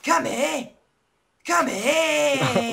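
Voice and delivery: high, loud calling out; high shouted calling. Acted. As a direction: yell come here over and over. Calling a pig over and over.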